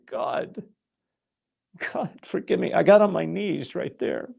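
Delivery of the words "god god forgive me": softly begging forgiveness, a near whisper; hushed, contrite cry for forgiveness; broken whispered plea. Retold or imitated. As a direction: whisper it like a broken man praying. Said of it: "I was so broken".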